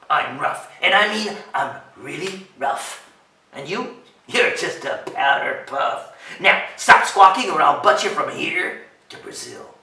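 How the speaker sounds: forceful, blustering boast; bullying goat. Acted. Ruff threatening little ogre, tough bully.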